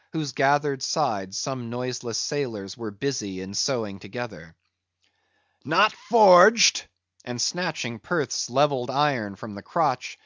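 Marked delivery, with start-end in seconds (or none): 5.6-6.9 loud, high cry of disbelief; indignant outburst. A narrator's reading. Ahab challenges the captain's claim, indignant outburst. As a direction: throw the words back, higher and louder.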